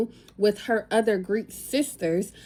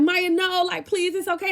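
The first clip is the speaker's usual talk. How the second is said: pleading to calm; 7 semitones higher than usual. Retold or imitated. Friends calming her down.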